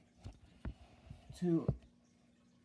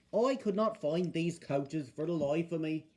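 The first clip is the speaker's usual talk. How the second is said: plain reading voice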